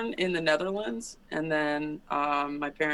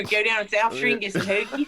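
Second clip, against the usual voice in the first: hammed-up Philadelphia accent, pitched up; Philly accent; 5 semitones higher than usual. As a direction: put on a playful South Philly accent. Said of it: "I don't hear the accent at all".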